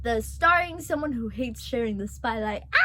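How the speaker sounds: ordinary talk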